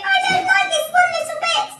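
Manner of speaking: high, loud wail of protest; panicked cry. A narrator's reading. Mister Twit upset at being upside down, panicked cry. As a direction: wail it out anxiously. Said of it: "cried Mister Twit".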